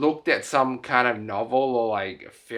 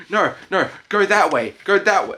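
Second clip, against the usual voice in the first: raised, higher urgent call; loud, pitched-up mock order; imitated guard calling out; 8 semitones higher, 6 dB louder than usual. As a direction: imitate a guard shooing someone off. Acts out guard directing someone away.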